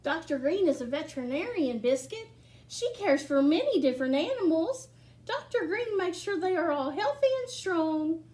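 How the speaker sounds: bright, lively picture-book reading; reading to children. Acted. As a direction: read it to a room of small kids. Reads picture book aloud.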